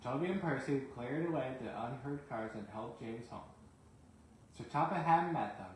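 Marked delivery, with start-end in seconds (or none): none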